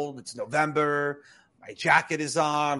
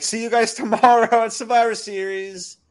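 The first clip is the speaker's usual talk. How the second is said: smug taunt; 8 semitones higher, 5 dB louder than usual. Retold or imitated. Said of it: "Complete douchebag".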